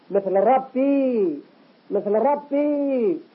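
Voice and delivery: ربي drawn out slowly with a full heavy R. Shown as a demonstration. Teacher shows a heavy ربي for students. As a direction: stretch ربي with a thick emphatic R. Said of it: "هذه الراء مفخمة".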